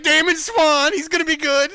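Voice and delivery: high, loud, overexcited and near tears; desperate hope. Retold or imitated. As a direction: squeal it like a fan clutching at straws. Mock hopeful fans' quote.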